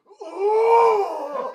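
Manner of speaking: loud laughing exclamation; hammed-up angry Morpheus outburst; laughing mock shout. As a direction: blurt it out with a laugh. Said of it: "He's like".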